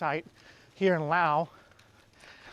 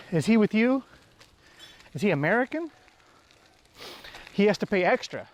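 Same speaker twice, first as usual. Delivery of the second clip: talking while winded; breathless from walking; out of breath; 4 semitones higher than usual. Real, not acted. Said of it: "Sorry, I'm a little out of breath there".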